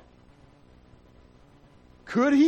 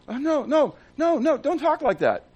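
worried acquaintance; 10 semitones higher than usual. Retold or imitated. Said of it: "People go".

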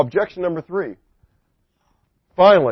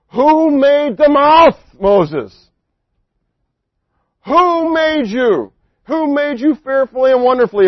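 much higher, louder, very slow divine voice; booming God; 8 semitones higher, 5 dB louder, slower than usual. Acted. Preacher voicing God questioning Moses, slow and booming. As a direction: draw out each word like God rebuking.

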